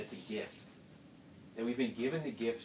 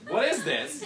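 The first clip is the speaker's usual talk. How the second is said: laughing; 10 semitones higher, 9 dB louder, slower than usual. Pretend. Israelites finding manna, laughing.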